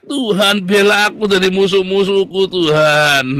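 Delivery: loud, high plea shouted to God; crying out. Acted. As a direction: cry out for God to defend you. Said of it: "Mazmur lagi teriak". (berteriak lantang memohon kepada Tuhan)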